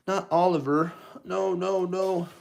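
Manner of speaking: high, loud panicked cry of no; horrified plea. Acted. Father crying out as Ratigan threatens his daughter, high and loud. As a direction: beg in horror for his daughter. Toymaker hears daughter taken.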